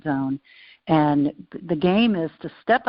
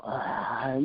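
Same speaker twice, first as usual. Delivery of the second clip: annoyed groan; 4 semitones lower, 7 dB quieter than usual. Retold or imitated. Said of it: "I could just go".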